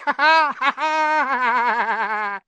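loud, high poltergeist cackle; gleeful cackle. Acted. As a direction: cackle with glee. Peeves cackling.

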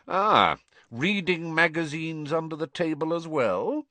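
high, mocking sneer; sneering Snape. A narrator's reading. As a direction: sneer over the magazine.

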